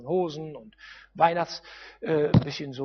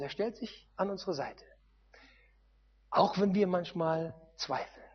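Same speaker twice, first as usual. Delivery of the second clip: normal talking voice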